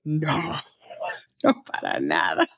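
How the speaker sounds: negando entre risas, más grave; un no rotundo con risa; riéndose. Retold or imitated. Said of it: "¿Y tú qué me dijiste?". (imitando una negativa riendo)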